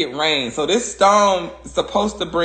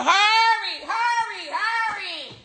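very high sing-out, fading softer; playful hurrying squeal; 3 dB quieter, slower than usual. Pretend. Speaker keeping things moving, playful hurrying squeal. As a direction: squeal each word high, trailing away.

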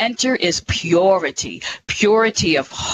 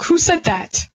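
startled question; 8 semitones higher than usual. Retold or imitated. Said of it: "I'm like".

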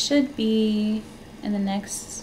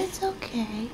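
soft soothing; 6 dB quieter than usual. Real, not acted. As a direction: let it fall to a hush. Soothing fussing newborn.

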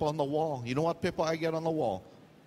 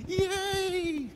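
a much higher, gleeful "Yay!"; excited squeal. Retold or imitated. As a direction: squeal like a thrilled rookie. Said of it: "I'm like".

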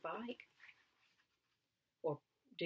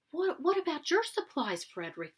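much higher, louder squeaky question from the little mice; eager mice asking; 10 semitones higher, 11 dB louder than usual. Acted. Mice pressing Frederick about his supplies, eager high questioning. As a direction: pop up high like excited field mice.

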